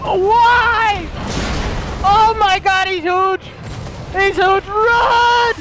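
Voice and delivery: stretched, incredulous high cries; shocked disbelief. Real, not acted. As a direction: shout in stunned amazement at a monster. Shocked at the boss's new size.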